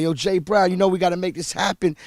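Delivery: loud, high, excited yell; hyped shout to the producer; screaming on the phone. Retold or imitated. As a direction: yell it at the phone, all fired up. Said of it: "I was screaming on the phone like".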